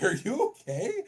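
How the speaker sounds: high, laughing are you okay; laughing concern. Retold or imitated. Husband finding wife in oven, high laughing disbelief. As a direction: ask it high through a laugh. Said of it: "I'm like".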